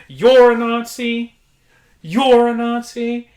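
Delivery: high, very loud, slow accusing yell; agitated patient yelling. Retold or imitated. Janitor imitating patient yelling at nurses, high and loud. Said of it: "She's like".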